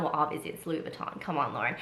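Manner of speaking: low, soft, fast self-scolding; quiet aside. Real, not acted. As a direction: mutter it to yourself.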